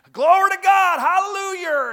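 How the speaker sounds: loud, high shouted praise; old man shouting hallelujah. Retold or imitated. Old man in a country church shouting glory and hallelujah. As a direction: belt it out like a backcountry worshipper. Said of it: "And they".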